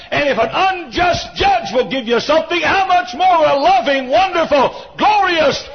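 building to high, loud praise; fervent preaching. Real, not acted.

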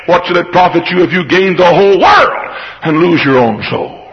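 ordinary talk, nothing marked

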